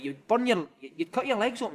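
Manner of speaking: ordinary talk, nothing marked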